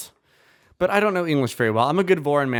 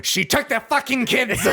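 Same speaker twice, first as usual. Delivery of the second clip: comic shout; 8 semitones higher than usual. Acted.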